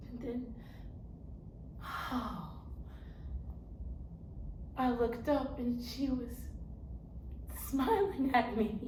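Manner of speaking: soft, halting wonder; hushed awe. Acted. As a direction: drop to a hush as relief dawns. Character awed by God's smile.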